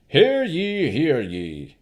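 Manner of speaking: high, loud herald's call; pompous Baron. Acted. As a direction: proclaim like a puffed-up noble. Baron opens his speech.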